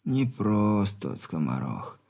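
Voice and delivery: weak stern dying father; very old man. Acted.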